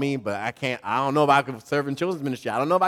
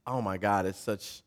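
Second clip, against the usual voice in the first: normal talking voice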